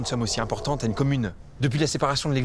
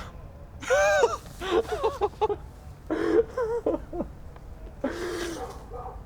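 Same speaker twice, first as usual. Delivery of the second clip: long high burst of laughter; giddy relieved laughter; laughing; 3 dB quieter than usual. Acted. Relieved after cracking the case.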